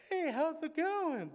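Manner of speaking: high, slow, bubbly hello to a stranger; chirpy outgoing greeter. Retold or imitated. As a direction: play the extrovert who greets everyone. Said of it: "they're not the ones going up to people".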